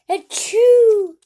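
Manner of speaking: big high acted sneeze; loud sneeze. Gerald sneezing all over Piggy, loud fake sneeze. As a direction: let a huge sneeze burst out.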